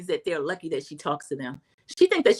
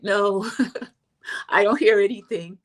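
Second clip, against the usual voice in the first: giggling through the words; laughing denial; 4 dB louder than usual. Retold or imitated. Angie laughing off Mary's question. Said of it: "Angie K is like".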